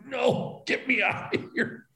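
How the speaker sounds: high, strained, hushed plea; acted-out agony, soft and pitched high; hurt and desperate. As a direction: squeeze it out as if in pain. Acts out himself hurt in pile.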